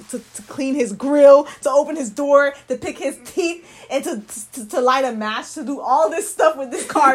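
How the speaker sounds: loud, rising exasperation, voice climbing with each item; worked-up rant. Real, not acted. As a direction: pile on the outrage item by item, laughing through it. Exasperated rant about a cartoon.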